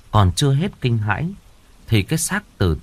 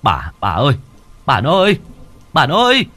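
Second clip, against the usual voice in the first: panicked yell, rising high and loud; shouting while running; 4 semitones higher, 5 dB louder than usual. Acted. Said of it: "Vừa chạy vừa nói như hét".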